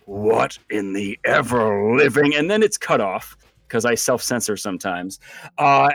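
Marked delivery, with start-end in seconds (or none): 0.1-2.4 tiger person voice. Acted. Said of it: "you hear the tiger person go".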